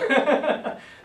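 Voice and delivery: loud, high burst of laughter. Real, not acted. Teacher jokes with class about an odd Greek word, laughing. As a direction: laugh out loud at your own joke. Joking about kai meaning and.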